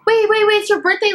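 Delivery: high, hurried imitation of a singer calling out; rushed pleading. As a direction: blurt it fast and high to stop someone leaving. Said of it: "said it really fast".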